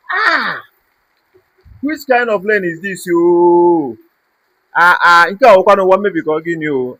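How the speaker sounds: loud exasperated outburst; frustrated shouting. Real, not acted. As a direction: shout it out in disbelief. Frustrated rant about flooding.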